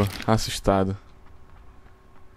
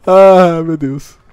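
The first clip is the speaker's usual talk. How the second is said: startled gasp; 6 semitones higher, 9 dB louder, much slower than usual. Real, not acted. Just escaped gunfire.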